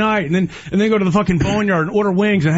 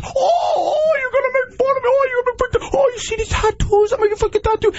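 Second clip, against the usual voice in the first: much higher, crying whine; mocking whiny clapback. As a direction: wail it like a hurt crybaby. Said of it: "That's your version of clapback. Is".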